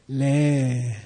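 long drawn-out 'Le'; longest length. Shown as a demonstration. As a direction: stretch the vowel of 'Le' right out. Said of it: "three different lengths of it".

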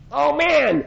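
high mock-amazed "oh man"; a monk gasping on meeting his teacher, mock amazed; amazed exclamation. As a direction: play the astonishment of meeting. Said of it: "they're like".

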